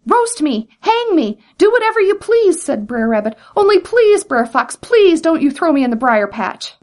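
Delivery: high, begging plea with mock terror; pleading. A narrator's reading. Brer Rabbit begging Brer Fox for mercy, high pleading. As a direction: beg as if terrified.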